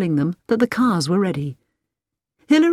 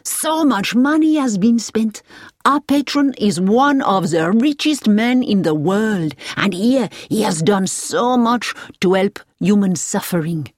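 put-on emphatic, higher French-accented praise; enthusiastic French driver; 4 semitones higher, 3 dB louder than usual. A narrator's reading. Driver tells of the rich patron, enthusiastic French driver. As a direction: extol the patron as a proud local driver.

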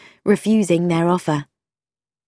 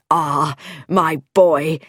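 slow, drawn-out fatherly address; paternal vicar; much slower than usual. Acted. Vicar begins a homily to his son, slow and paternal. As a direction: draw it out like a pompous parson.

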